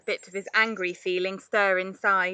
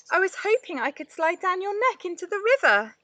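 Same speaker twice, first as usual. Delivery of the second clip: calling out; 10 semitones higher, 4 dB louder, faster than usual. A narrator's reading. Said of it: "he called".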